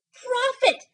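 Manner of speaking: high, loud desperate call; anguished cry. A narrator's reading. Narrator crying out to the raven in despair. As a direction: hurl the word at the bird. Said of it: "said I".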